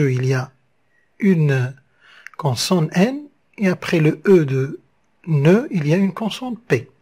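normal talking voice

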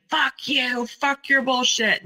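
quoting an angry scream, pitch jumped up; retold scream. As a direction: imitate his sudden screamed outburst. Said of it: "suddenly he was screaming".

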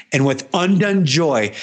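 usual speaking voice

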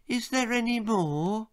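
put-on high, small child's voice; eager boy. A narrator's reading.

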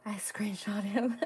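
slow whispered aside; whispering. Real, not acted. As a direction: whisper it to yourself. Quietly noting a buyer's screenshot.

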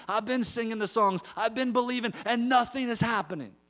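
high exasperated complaint; frustrated believer. Retold or imitated. Churchgoer vents at God, high exasperated whine. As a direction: cry out high, fed up with waiting. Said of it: "get frustrated and say".

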